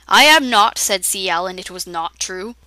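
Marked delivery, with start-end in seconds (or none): 0.1-0.8 loud denial. Acted. Denying being an alcoholic.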